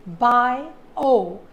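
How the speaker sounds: Bio split into two beats, loud and deliberate; syllable by syllable. Shown as a demonstration. Teacher shows two syllables, saying Bio in separate beats. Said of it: "it has two syllables".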